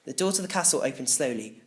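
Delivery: ordinary delivery, nothing marked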